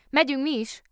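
the bear announcing he is leaving too; bear's voice. Acted. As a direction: chirp it like a cheerful bear. Bear speaks suddenly.

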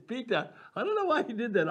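ordinary talk

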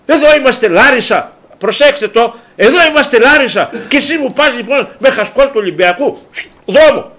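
indignant boss, high and loud; Kougias. Acted. Club boss sacking doctor over an Olympiakos scarf, indignant. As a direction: dismiss him in outraged, emphatic bursts. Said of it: "λέει ο Κούγιας".

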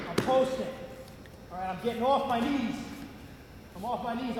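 ordinary delivery

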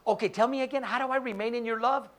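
ordinary delivery

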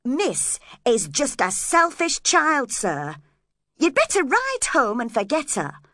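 loud, emphatic advice; calling out. A narrator's reading. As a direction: call it across the room to urge someone off. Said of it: "I called out to him".

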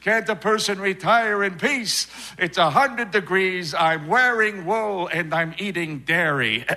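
complaining Florida retiree voice. Acted. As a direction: gripe like an old retiree in Florida. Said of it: "you drive down south to Florida".

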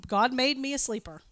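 normal talking voice

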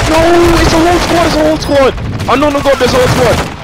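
alarmed shout of enemy squad; combat callout. Real, not acted. Spotting enemy squad mid-fight.

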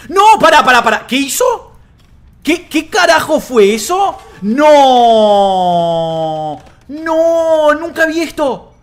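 loud, high cries of disbelief; stunned outcry. Real, not acted. Streamer reacting to a move he's never seen, stunned outcry. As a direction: cry out in shock, stretching the no. Astonished by an unseen game move.

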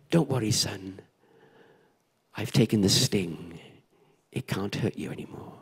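low, soft fatherly calm; tender reassurance. Retold or imitated. Father comforts his scared son after the sting, tender reassurance.

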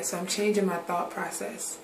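ordinary talk, nothing marked

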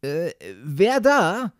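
loud, drawn-out challenge; calling out. A narrator's reading. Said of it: "rief er".

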